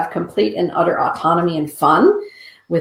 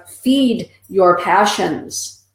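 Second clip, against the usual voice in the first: slow emphatic; 2 semitones higher, much slower than usual. Pretend. Card message.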